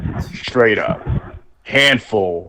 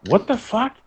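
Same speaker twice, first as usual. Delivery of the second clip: stunned disbelief; 13 semitones higher, 5 dB quieter than usual. Retold or imitated.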